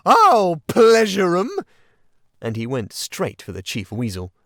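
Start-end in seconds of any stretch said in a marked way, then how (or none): 0.1-1.5 high, loud, slow vengeful roar; furious yell. A narrator's reading. Toad charging the Chief Weasel, furious roar. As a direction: spit it out as he charges. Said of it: "he yelled".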